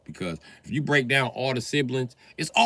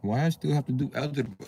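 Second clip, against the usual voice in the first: normal talking voice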